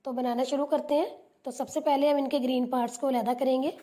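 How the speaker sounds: ordinary talk, nothing marked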